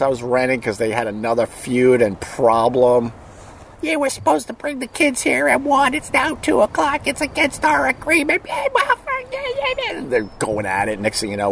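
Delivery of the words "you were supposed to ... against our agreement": mocking shrill high ex-wife voice, much higher; screeching ex-wife. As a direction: nag him about the late drop-off in a squeaky mock voice. Landlord couple fighting on lawn.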